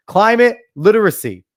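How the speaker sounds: loud, high scornful echo; incredulous loud mockery; mocking repeat. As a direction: sneer the buzzword back.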